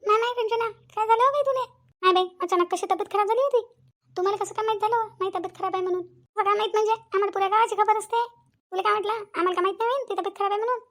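a high, prying visitor; nosy neighbor woman. Acted. Neighbor women visiting sick Ranjana, high and prying.

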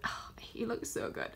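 soft whispered swoon. Real, not acted. Admiring [name]'s card, a soft whispered swoon. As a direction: breathe it out, smitten.

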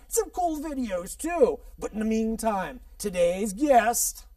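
hyped-up TV host; show host intro. Acted.

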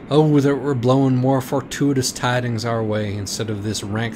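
loud, grand tragic recital; hammed-up tragic monologue; mock tragic lament. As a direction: declaim like a doomed heroine.

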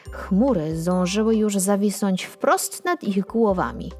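usual speaking voice

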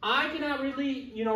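ordinary talk

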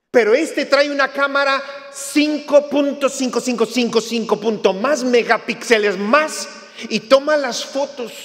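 loud, high, excited pitch for a gadget; eager sales pitch. Retold or imitated. Preacher mimicking a pushy salesman, loud and eager. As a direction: sell it hard like a pushy salesman.

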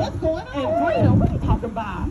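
sudden high-pitched disbelief; startled. Acted. Mother hearing the children shout about freedom, startled. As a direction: jump up in surprise at the word freedom.

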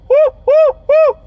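loud high whooping call; Bigfoot call. Shown as a demonstration. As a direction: whoop out into the woods. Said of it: "I'm gonna make a Bigfoot call now".

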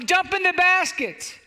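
high, slow acted shout; drawn-out rescuer's call. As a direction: holler down to someone below. Helicopter crew calls down.

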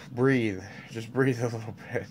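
soft, sighing self-calming whisper; calming breath. Real, not acted. Telling himself to breathe, soft and sighing. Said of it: "I need".

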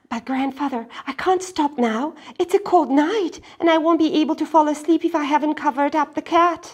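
put-on high, loud, fast child; urgent girl. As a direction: plead in a rush. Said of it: "Miyuki pleading".

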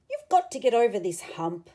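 ordinary delivery, nothing marked

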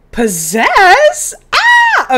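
high, loud squeal of delight; excited shriek. Real, not acted. Player thrilled by the ghost possess option, shrieking. New haunting option appears.

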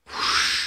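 whispered rush of water; breathy whoosh. Retold or imitated. Preacher voicing the sea parting with a whisper.

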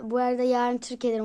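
usual speaking voice

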